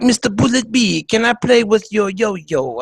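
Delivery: coy mock Eastern European accent; Melania Trump. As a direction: suggestive imitation with a put-on Slavic accent. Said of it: "She'd be like".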